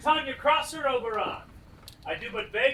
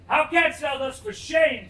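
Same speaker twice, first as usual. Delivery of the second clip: angry shout; 3 semitones higher than usual. Acted. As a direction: shout him down in anger. Oberon shouts at Titania.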